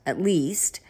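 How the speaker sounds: whispered aside; whispering. Real, not acted. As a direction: drop to a whisper.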